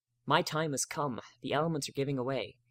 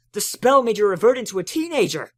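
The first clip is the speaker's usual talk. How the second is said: high, loud exclamation; Spike alarmed; 8 semitones higher, 11 dB louder than usual. A narrator's reading. Spike announces his dramatic conclusion to Twilight, high and loud. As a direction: blurt out the discovery in horror.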